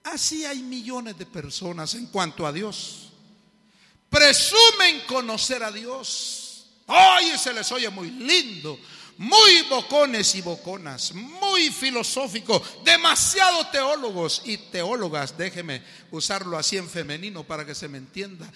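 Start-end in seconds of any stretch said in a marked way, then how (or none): 4.1-15.4 loud, slow, high preacher's cry; a pastor thundering from the pulpit; fiery preaching. Acted. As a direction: rebuke the congregation at full force. Preaching at full force.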